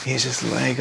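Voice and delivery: ordinary delivery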